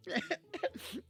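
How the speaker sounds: high comic sob; elf sobbing. A narrator's reading. Elf breaks down before the knight, sobbing. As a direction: burst into hammed-up little sobs. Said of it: "Elrondo wailed".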